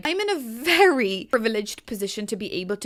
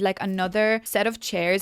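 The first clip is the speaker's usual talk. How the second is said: ordinary delivery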